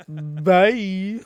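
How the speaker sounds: high, loud singsong goodbye; hammed-up long "bye"; drawn-out farewell. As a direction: stretch it out, rising and loud. Acted farewell in game.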